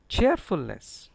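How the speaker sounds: slow cheerfulness with a strong first syllable; deliberate model CHEERfulness; stress on CHEER. Shown as a demonstration. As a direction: lean on CHEER in cheerfulness, then fall. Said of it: "Stress is on cheer".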